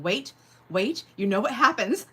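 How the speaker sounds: a playful know-it-all tone; judgy inner voice. Retold or imitated. Voicing her own judgy inner thought. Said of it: "my little judgy moment".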